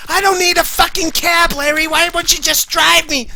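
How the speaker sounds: hammed-up furious Susie; angry Susie. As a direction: yell at Larry like a hysterical woman. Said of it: "She's like".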